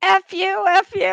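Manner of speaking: loud, high-pitched mock yell; screaming back. Retelling her own airport outburst, imitated yelling. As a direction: shout it back at him like a toddler. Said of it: "I started screaming".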